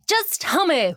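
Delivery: high-pitched begging girlfriend imitation; mock pleading. Acting out girlfriend pleading for confession.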